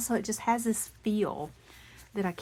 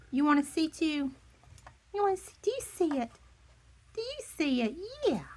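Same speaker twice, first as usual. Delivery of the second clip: much higher, sing-song voice for the dog; 10 semitones higher, 4 dB louder, faster than usual. Real, not acted. Baby talk to the pet.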